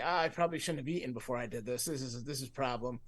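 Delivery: soft, quick queasy self-talk; retold queasy regret, kept low in volume; nauseous. Retelling lying down stoned and queasy, nauseous. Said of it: "then I started getting nauseous".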